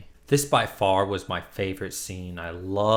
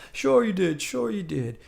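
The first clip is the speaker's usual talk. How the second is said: much higher, dismissive sing-song; mocking doctor; 10 semitones higher than usual. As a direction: humor the crazy patient in a high voice.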